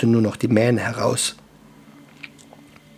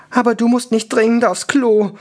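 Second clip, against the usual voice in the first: high-pitched, tight character voice; pinched, strained; 11 semitones higher, 4 dB louder than usual. A narrator's reading. Ankhor to the dwarf about needing the toilet, pinched. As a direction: say it pinched and high, holding back. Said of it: "sagte Ankhor verkniffen".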